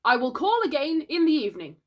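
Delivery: high, loud parting pronouncement; boy's puffed-up doctor voice; pretend doctor. A narrator's reading. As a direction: sign off importantly as a make-believe doctor.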